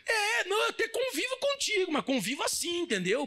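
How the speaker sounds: high-pitched, whiny hedging; wary forgiver. Acted. Forgiver keeping the offender at arm's length, wary.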